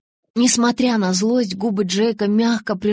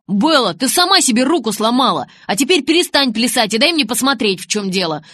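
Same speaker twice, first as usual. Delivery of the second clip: loud, raised, scolding voice; exasperated retort; 4 semitones higher, 7 dB louder than usual. Acted. Jacob telling injured Bella to hold still, exasperated. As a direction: scold her loudly and impatiently. Jacob snapping back in quarrel.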